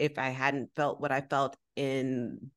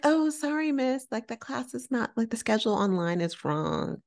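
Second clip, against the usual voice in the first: mock-polite high voice breaking bad news; apologetic staff member; 8 semitones higher than usual. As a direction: imitate an apologetic receptionist. Staff apologising for schedule mistake.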